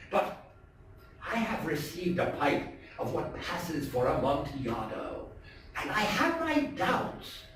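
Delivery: lightly voiced sly host, mock friendly; Montresor's sly greeting. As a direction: purr the welcome with a hidden sneer. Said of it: "I said to him".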